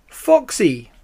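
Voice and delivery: high, loud answering call; excited cry. A narrator's reading. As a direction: call out to an old friend. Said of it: "cried Badger".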